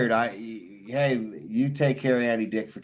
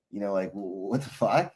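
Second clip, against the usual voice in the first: ordinary talk, nothing marked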